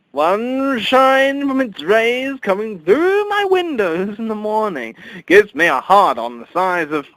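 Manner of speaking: high, loud, slow shouted boast; loud rant. Acted. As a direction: bellow it like a proud confession. Absurd fetish rant peaks.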